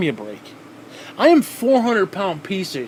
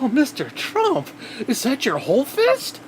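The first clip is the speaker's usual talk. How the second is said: higher, slow, simpering mock fan; fawning Trump fan; 8 semitones higher than usual. Vlogger mocks a Trump fan, higher and simpering. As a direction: coo it like a starstruck devotee. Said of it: "who are like".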